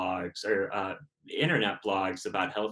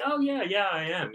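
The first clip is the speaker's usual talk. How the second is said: high, bright yes-yes lie; fake eager agreement; 10 semitones higher than usual. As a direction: squeak out a cheerful fib. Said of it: "I'd lie and be like".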